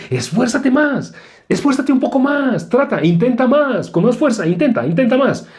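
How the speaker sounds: a mocking Pharisee pushing her to try harder; demanding Pharisee. Preacher mocking a Pharisee's demands to try harder, high and nagging. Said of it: "en el sentido de".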